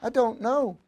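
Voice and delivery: little dog voice. A narrator's reading. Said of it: "replied the little dog".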